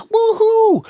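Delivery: high loud cheering whoop; crowd woo-hoo. Retold or imitated. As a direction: let out a fan's cheer from the stands. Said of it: "someone in the crowd goes".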